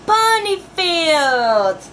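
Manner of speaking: put-on slow, drawn-out list for kids to repeat; teacher-paced recital; slow call and response. Shown as a demonstration. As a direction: stretch each place name out. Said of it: "Say with me".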